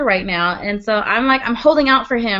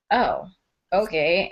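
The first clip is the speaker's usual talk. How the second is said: deflated dad; 6 semitones lower, 5 dB quieter, slower than usual. Retold or imitated. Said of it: "look at the dad and he's like".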